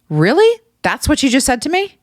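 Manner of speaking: high-pitched, offended disbelief; re-enacted indignant questioning, high; incredulous challenge. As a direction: act out fed-up disbelief, pitch shooting up. Acting out fed-up challenge to coworker.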